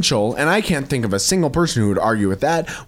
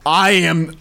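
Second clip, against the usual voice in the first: ordinary delivery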